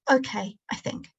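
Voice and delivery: ordinary delivery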